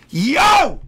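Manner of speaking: high, loud, laughing whoop; real excited laughing yell. Real, not acted. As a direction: burst out with joy. Excited outburst.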